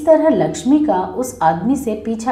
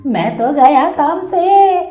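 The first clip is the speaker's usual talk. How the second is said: slow, loud cry of panic; shouting; 4 semitones higher, 4 dB louder, slower than usual. Acted. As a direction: yell it out, dragging the words. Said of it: "वो जोर से चिल्लाता है".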